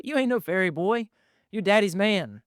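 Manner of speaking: dad teasing his son, lightly gruff; playful dad. Retold or imitated. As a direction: tease him like a proud father. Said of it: "dad voice in story".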